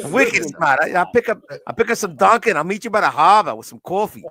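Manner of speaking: hammed-up Bostonian ordering Dunkin'; mock Boston accent.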